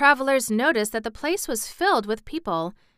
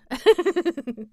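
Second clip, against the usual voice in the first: real laugh; 9 semitones higher than usual. Real, not acted. Reader laughs at story.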